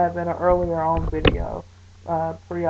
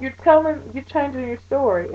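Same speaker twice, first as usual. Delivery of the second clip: mocking the nurse; 7 semitones higher than usual. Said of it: "she's like".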